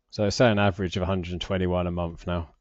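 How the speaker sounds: ordinary talk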